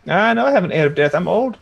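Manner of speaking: high, loud-edged granny retort, climbing as it goes; Nanny retorting. Acted. Nanny answering a rude kid, testy high character voice. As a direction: retort in character, pitch rising to the end.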